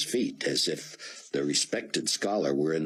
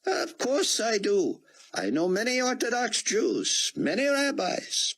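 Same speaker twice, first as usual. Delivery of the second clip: put-on high, foreign-sounding older gentleman; old Israeli scholar. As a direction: play the kindly old statesman, higher. Rosenzweig voiced by the narrator.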